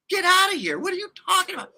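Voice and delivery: very high-pitched, incredulous outburst; astonished disbelief. Retold or imitated. Man reacting to girlfriend's mailing plan, astonished. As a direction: squeal it in mock disbelief.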